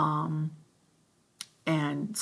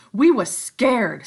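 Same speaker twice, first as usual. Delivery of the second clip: slow, higher, louder eager insistence; excitedly; 7 semitones higher, 13 dB louder, slower than usual. Acted. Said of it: "said Piggy excitedly".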